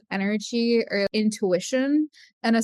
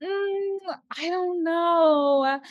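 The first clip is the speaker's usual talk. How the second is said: slow, drawn-out unsure hum; hesitant doubt; 6 semitones higher, much slower than usual. Retold or imitated. Sarah unsure about phone reading, slow hesitant hum. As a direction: let the doubt hang, dragging it out. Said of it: "She was like".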